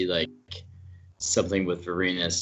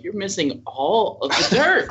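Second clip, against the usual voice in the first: exasperated outburst; 12 semitones higher, 7 dB louder, slower than usual. Retold or imitated. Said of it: "I'm like".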